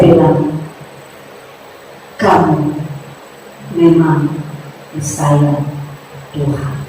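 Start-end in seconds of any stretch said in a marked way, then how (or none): 2.2-6.7 soft, low and slow, fading to almost nothing; tender low words. Retold or imitated. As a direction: say it gently like a blessing. Preacher quotes his own tender words. (lembut dan pelan, makin lirih)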